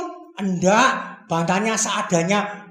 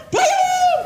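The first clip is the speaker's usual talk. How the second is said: frantic shouts; 4 dB louder than usual. Acted. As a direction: scream orders in panic. Frantic orders in the storm. (murid berteriak panik menurunkan dayung)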